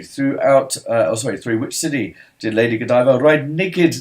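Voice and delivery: normal talking voice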